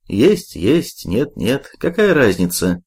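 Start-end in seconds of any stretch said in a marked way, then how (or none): none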